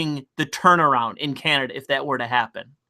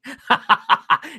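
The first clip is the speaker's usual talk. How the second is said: real laugh; 8 semitones higher than usual. Real, not acted. Laughs at own story.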